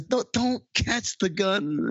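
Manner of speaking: high, slow put-on voice; mock warning. Bateman scolding Charlie Day, slow high mock voice. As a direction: drag it out in a silly high voice. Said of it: "And says".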